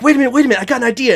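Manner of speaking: high-pitched, eager retold interruption; imitated sudden excitement. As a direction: act out someone bursting in with an idea. Retelling an actor's sudden idea.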